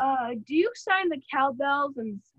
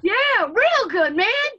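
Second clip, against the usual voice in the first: loud, high, drawn-out enthusiasm; excited agreement; 8 semitones higher, 9 dB louder, slower than usual. Real, not acted. Interviewer cheering the cowbell's auction price, excited agreement. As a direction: stretch it out loudly, pitch climbing.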